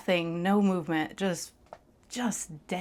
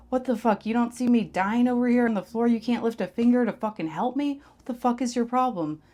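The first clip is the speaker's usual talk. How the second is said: higher, louder angry yelling; furious shout; 6 semitones higher, 7 dB louder than usual. Retold or imitated. Yelling at the friend who did not help her. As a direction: storm over and yell at him. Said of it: "I start walking over to him like".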